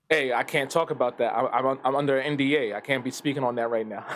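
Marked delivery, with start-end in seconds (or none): none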